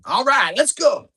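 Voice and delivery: high, loud rowdy cheer; dad hollering at ringside. Retold or imitated. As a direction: pump the kid up from the sidelines. Dad clapping at the mat.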